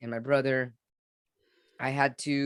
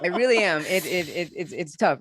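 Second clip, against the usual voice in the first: stammering through real emotion and laughter; choked up, laughing through halting words; 4 semitones higher, 5 dB louder than usual. Real, not acted. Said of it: "I'm feeling choked up".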